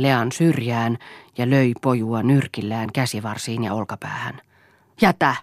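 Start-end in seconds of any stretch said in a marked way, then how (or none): 5.0-5.4 raised, louder cry to stop; shouting. A narrator's reading. Mother trying to stop the beating, shouting. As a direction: call out to halt him. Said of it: "huusi Lea".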